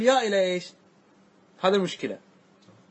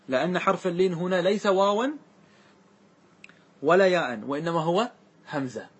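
ordinary delivery

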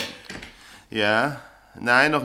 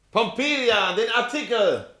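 calling out; 13 semitones higher, slower than usual. Acted. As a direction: shout the order across the room. Editor calls for the article.

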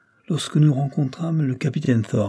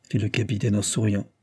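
ordinary delivery

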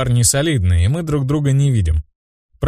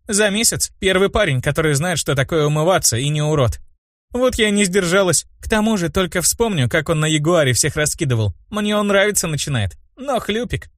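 lightly voiced girl, pitched up; girlish higher voice, confiding; chatty brunette; 8 semitones higher than usual. A narrator's reading. Brunette confessing interest to her friend, lightly girlish.

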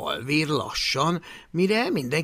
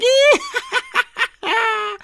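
high, loud cackling laugh; raucous laughter; 8 dB louder than usual. Acted. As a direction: howl with mocking laughter. Mocking laughter. (harsány röhögés)

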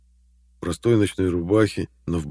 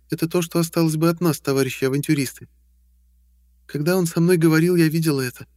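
high clear female voice; young woman voice; 10 semitones higher, 3 dB louder than usual. Acted. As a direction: speak as a higher woman, explaining. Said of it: "заговорила Таша".

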